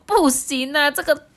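high, loud whimpering complaint; hammed-up tearful frog; whiny frog. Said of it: "第一只青蛙哭丧脸说".

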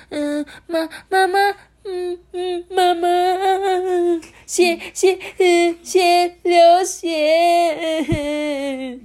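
put-on very high halting child wail; panicked stammering. A narrator's reading. Little Lemon tells auntie about blood, panicked stammer. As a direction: choke out broken words, drawing the last one into a long wail. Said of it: "小柠檬就很紧张又慌张嘛".

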